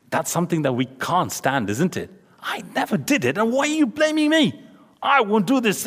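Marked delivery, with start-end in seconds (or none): 2.4-5.8 high, whiny, wounded denial; mock indignant protest. Preacher imitating someone wrongly accused, high whiny protest. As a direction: play the wrongly blamed teen protesting.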